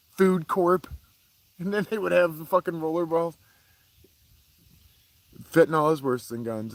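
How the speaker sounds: usual speaking voice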